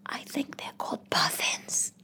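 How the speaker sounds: slow, hushed aside; whispered correction. Retold or imitated. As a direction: whisper it discreetly, drawing it out. Timidly correcting the loud woman.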